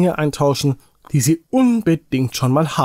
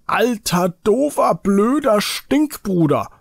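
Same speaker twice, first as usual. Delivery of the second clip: put-on higher, slower childish insults; a boy hurling names at his brother, pitched up; angry insults; 7 semitones higher than usual. A narrator's reading. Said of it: "schrie Robert".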